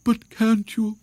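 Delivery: high, pleading owl; interrupting owl. A narrator's reading. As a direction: cut in with a high-pitched plea. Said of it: "interrupted Owl".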